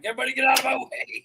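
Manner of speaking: high, loud and rushed; urgent yell. Retold or imitated. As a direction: bark it, desperate to get through. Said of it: "I'm like".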